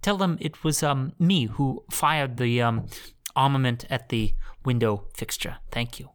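hammed-up hesitant politeness; timid polite mobster. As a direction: plead meekly, fading almost to a whisper. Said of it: "he has to pretend to be a mobster".

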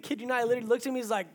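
usual speaking voice

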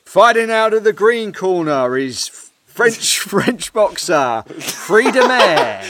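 slow, high, loud fight-night introduction; ring announcer. Pretend. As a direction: draw out the names like a boxing MC. Mock ring announcer introducing boxer.

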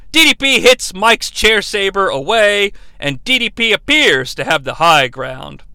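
usual speaking voice

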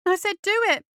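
high, loud insistent dare; furious challenge, pitched right up; trembling fury. A narrator's reading. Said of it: "his voice trembling".

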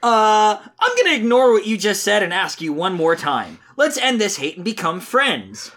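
high, sing-song earnest Sonic. Acted. Reader voices Sonic defending his friend, high and earnest. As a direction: preach friendship in a high, naive hero voice. Reads Sonic's comic lines.

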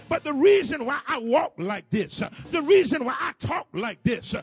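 loud, climbing preacher's whoop, building to a shout; full-force preaching. Real, not acted. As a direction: preach it hard, loud and rising as the congregation answers.

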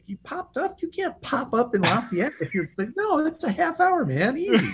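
high-pitched, amused disbelief; mock incredulous. Podcaster scoffing at a book character's drive times, mock incredulous. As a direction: scoff in a squeaky, incredulous rise.

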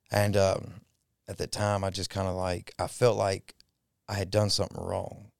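normal talking voice

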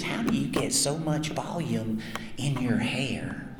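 slow, quiet, deadpan question; imitating the girlfriend. Retelling the girlfriend's question to the crowd, slow deadpan. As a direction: repeat her question dead serious and slow. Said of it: "She was dead serious".

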